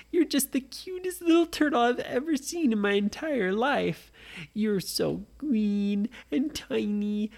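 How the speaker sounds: cooing over a tiny pet; gushing. Acted. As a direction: melt over something cute. Nora gushes over the turtle.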